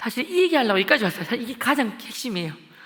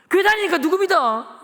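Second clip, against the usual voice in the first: loud, high threatening questions; bully the kids into answering; menacing interrogation; 9 semitones higher, 7 dB louder than usual. Retold or imitated. Schoolboy grilling classmates about church, loud and threatening.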